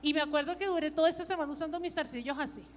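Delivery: ordinary delivery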